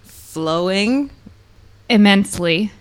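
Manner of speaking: one word at a time, paused; staccato. Acted. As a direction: drop each word alone, with gaps. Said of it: "One, one word at a time?".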